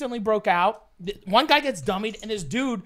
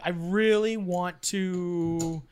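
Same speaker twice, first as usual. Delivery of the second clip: slow, stretched-out words of wanting; retold craving, drawn right out; longing drawl; much slower than usual. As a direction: drag it out with exaggerated yearning. Acting out his longing back then.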